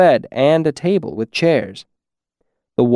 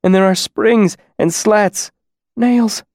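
much higher, fearful and embarrassed; horrified; 9 semitones higher, slower than usual. Acted. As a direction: blurt it out in embarrassed horror. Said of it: "somewhere between horror and embarrassment".

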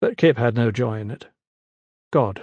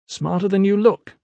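mocking scholar; 8 semitones higher than usual. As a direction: sneer at the newcomer. Young Luxiat mocking.

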